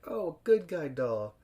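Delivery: lightly voiced cop, drawn out; slow amused cop. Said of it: "And he's like".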